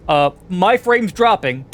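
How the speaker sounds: loud, high showman opener; big announcer welcome; hyped host intro. Acted. Over-the-top podcast host intro.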